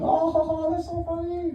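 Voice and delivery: high mock chuckle; imitated laughing neighbours. Comedian mimics neighbours watching the toddler, high mock laughter. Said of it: "they're laughing".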